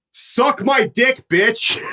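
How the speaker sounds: high, drawn-out jeer, imitating a friend; mock taunting call. Teller mimics friend goading a bully across the street, mock taunting. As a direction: stretch the taunt out, pitched high.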